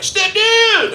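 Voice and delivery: high, drawn-out holler; mock stepdaughter shriek, last word stretched; excited stepdaughter yell. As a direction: imitate her calling out at full pitch. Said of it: "and she's like".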